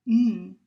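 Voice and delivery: "mm" as one loud held hum; isolated sound. Shown as a demonstration. Teacher giving students the sound to drop, isolated "mm". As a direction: hum "mm" alone, as a single speech sound. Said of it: "but don't say".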